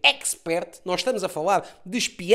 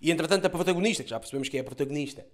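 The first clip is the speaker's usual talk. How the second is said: normal talking voice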